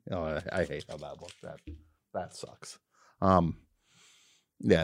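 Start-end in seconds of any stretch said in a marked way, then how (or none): 0.1-2.8 muttered aside. Real, not acted. Muttered under breath.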